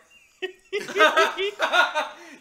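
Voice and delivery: loud, high cackling laughter; shrieking laugh. Real, not acted. Laughing at a fall.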